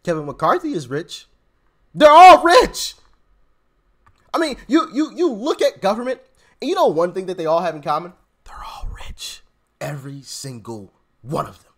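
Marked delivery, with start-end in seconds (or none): 1.9-2.9 loud, higher, slow punchline; emphatic shout. Real, not acted. Telling viewers politicians are all rich, loud slow shout. 8.4-11.7 hushed whispered secret; conspiratorial whisper. Real, not acted. Sharing a secret with viewers, soft whisper.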